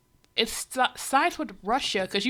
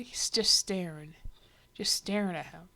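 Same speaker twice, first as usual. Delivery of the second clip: hushed whisper; 3 semitones lower than usual. Real, not acted. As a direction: whisper it like a creepy secret. Commenting on creepy staring.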